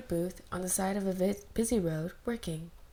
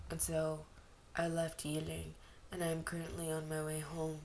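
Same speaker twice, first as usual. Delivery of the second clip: quiet; 3 semitones lower, 7 dB quieter than usual. Acted. Wangji ends story quietly.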